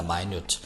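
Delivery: minute said softly as mai-nyoot; pronunciation demo. Shown as a demonstration. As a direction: say minute as my-noot. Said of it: "발음 조심이라 minute". (minute를 마이뉴트로 또박또박)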